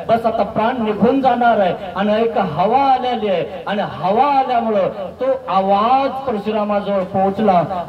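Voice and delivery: ordinary talk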